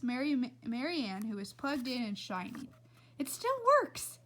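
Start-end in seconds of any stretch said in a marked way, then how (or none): 3.2-4.2 delighted. Acted. Tootie delighted with lamp.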